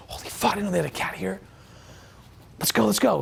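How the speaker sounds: hushed-urgent fake surprise; mock surprise. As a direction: act startled and hurry them along. Acted surprise sneaking past cat.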